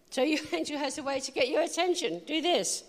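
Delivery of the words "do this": ordinary talk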